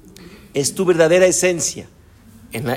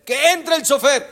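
calling out; 9 semitones higher than usual. Retold or imitated. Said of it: "y grita".

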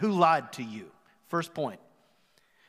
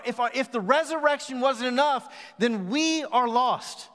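preaching; 3 semitones higher than usual. Acted. Preaching at full force.

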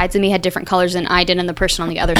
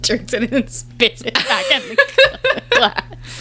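laughing; 3 dB quieter, much slower than usual. Real, not acted. Laughing through words.

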